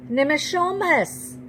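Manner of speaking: high drawn-out call. A narrator's reading. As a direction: call again, searching. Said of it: "I call and call for my great-grandfather".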